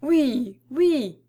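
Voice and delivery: lightly acted group assent; chorus of guests. A narrator's reading. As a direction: chime in like a crowd of guests. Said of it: "dirent en chœur tous les convives".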